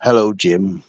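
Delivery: low put-on voice greeting Jim by name; impression of Jim. Streamer redoing his Jim impression for the chat, low put-on greeting. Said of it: "I did an impression of Jim".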